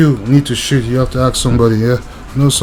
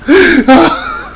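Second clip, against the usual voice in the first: high wheezing cry of laughter. Real, not acted. Vlogger wheezes with laughter at his own rant. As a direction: let a squeaky laugh escape.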